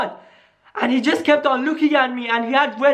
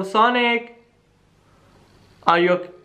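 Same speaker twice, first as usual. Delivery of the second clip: ordinary talk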